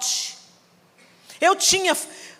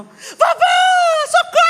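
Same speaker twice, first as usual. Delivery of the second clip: put-on much higher, louder terrified child's scream for help; grito de socorro; 7 dB louder than usual. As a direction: shriek for grandma as if in panic. Criança pedindo socorro na briga. (criança gritando socorro, bem aguda e alta)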